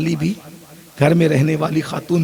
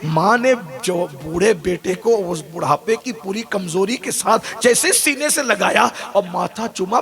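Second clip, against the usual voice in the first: ordinary delivery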